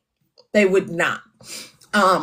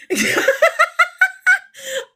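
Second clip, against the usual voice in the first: very high burst of laughter; high shrieking laugh; 4 dB louder than usual. Real, not acted. Reacting to a comment.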